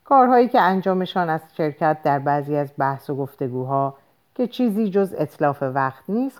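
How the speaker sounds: plain reading voice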